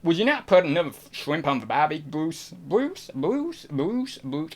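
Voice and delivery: Australian accent with each name swooping upward. Shown as a demonstration. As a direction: play around with an Aussie lilt. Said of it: "I can't do any others".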